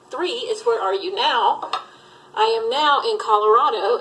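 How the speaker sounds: ordinary delivery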